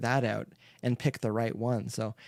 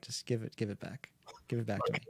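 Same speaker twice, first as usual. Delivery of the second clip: quieter, lower imitated request; mock muted dismissal; 5 semitones lower, 10 dB quieter, faster than usual. Guitar-playing friend asks for it back, mock muted. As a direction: toss it off softly and low. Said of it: "you're just like".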